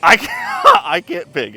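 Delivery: high, laughing and helpless. Real, not acted. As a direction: giggle through it. Torn between two cars, laughing.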